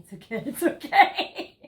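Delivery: mock soothing through giggles; laughing soothing. Your person calming you, soothing words broken by laughter. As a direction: giggle while you reassure.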